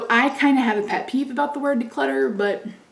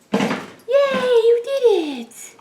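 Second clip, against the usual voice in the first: very high, louder cheer; excited praise; 14 semitones higher, 4 dB louder, much slower than usual. Real, not acted. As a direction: praise the pet with delight. Cat made the jump.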